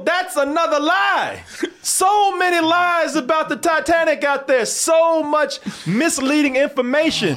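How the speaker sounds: decry it slowly like a mock crusader; hammed-up righteous complaint; mock indignation. Mock tirade against lies about Titanic.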